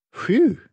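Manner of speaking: loud, high sigh of relief; relieved phew. Acted. Dog relieved after the beast scares off the dragon. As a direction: blow out a big phew. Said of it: "said the dog".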